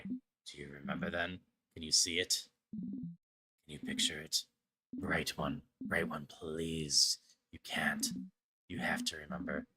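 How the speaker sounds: a lightly voiced mysterious figure begging; pleading stranger. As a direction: urge them to remember, gently and low. Voices a game character's plea.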